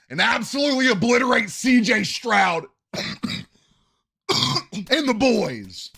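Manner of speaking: high, loud hype yell, breaking into a cough. Real, not acted. Fan predicting the Bears crush the Texans, yelling. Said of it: "I can't do my yelling voice".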